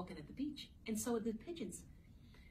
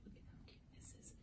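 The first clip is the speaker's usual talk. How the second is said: hushed whisper; 19 dB quieter, faster than usual. Real, not acted. Teacher whispering to the class about the cute picture. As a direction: whisper it as an aside. Aside while showing the page.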